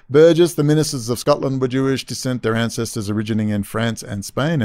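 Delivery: ordinary talk, nothing marked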